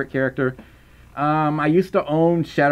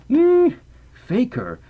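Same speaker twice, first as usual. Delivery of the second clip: Shadow voice; 10 semitones higher, much slower than usual. Retold or imitated. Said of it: "He said something like that".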